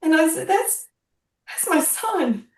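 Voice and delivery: high, choked cry of recognition; mother seeing her son's face in the paper, choked up; overcome. Retold or imitated. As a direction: let the voice break on my son. Sees her dead son's photo.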